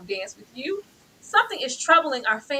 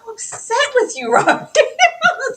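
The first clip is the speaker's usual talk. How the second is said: high, loud, slow laughing cry of anger; laugh-shout your frustration; a host venting about Robbie, laughing loudly; laughing outburst; 8 dB louder, slower than usual. Real, not acted. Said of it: "laugh shout".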